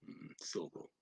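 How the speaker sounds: soft whisper. Shown as a demonstration. Teacher modelling the English word for French learners, in a whisper. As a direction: whisper the word syllable very softly, barely voiced. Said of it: "Okay, like syllable".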